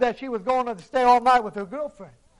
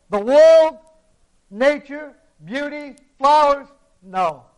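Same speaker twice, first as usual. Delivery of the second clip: louder, building sermon proclamation; preaching at full force; 5 dB louder than usual. Acted. Preacher rallying the congregation about creation, loud preaching. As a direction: drive each phrase home to the congregation.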